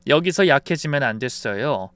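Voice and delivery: ordinary delivery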